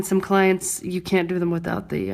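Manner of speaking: ordinary talk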